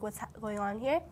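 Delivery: ordinary delivery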